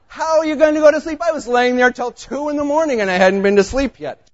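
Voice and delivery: high, loud and fast, fed up; venting his sleepless frustration; exasperated outburst. Real, not acted. Telling the congregation of the sleepless night, exasperated and loud.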